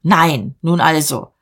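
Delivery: ordinary delivery, nothing marked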